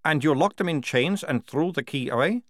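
a touch of disbelief in the question; incredulous captain. Acted.

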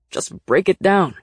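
slow, loud, forceful order; growing impatient, push each word out; loud, drawn-out command; impatient demand. A narrator's reading. Nick impatient, about to kick door.

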